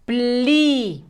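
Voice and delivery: "Pli" as one syllable with a long i; blended syllable. Shown as a demonstration. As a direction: join pl and i into a drawn-out "Pli". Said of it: "juntamos pl con la i". ("Pli" en una sola sílaba, alargando la i)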